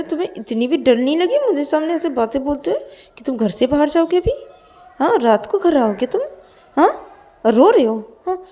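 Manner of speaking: high, loud, threatening telling-off; angry Payal, scolding. Acted. Payal orders a crying Ananya to stop crying, angry scolding.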